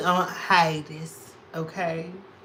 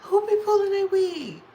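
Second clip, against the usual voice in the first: high shrieking squeal; excited high-pitched squeal; 13 semitones higher, 4 dB louder than usual. Real, not acted. Reacting to a comment, shrieking.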